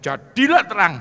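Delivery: high, loud decree; loud command. Shown as a demonstration. Preacher showing God's command, loud decree. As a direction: proclaim it like God creating light. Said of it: "Dia ngomong".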